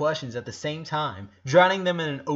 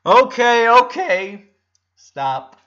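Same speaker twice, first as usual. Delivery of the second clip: loud, high, firm but smiling; smiling order; 10 semitones higher, 15 dB louder, much slower than usual. A narrator's reading. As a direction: take command cheerfully. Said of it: "ordered Laurie with a big smile".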